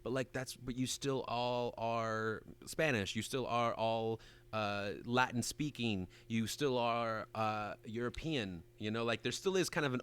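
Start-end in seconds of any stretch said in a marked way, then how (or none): 0.6-8.6 slow, soft, deliberate stress on each identity; slow emphasis. Real, not acted. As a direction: drag it out to drive the point home. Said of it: "emphasising point slowly".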